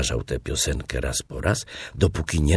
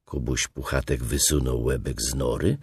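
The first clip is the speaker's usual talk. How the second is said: plain reading voice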